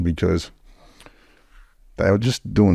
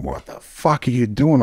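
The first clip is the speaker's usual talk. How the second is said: alarmed mate; 6 semitones higher than usual. Retold or imitated. Mate reacts during robbery.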